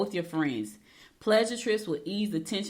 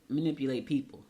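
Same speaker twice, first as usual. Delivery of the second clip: ordinary delivery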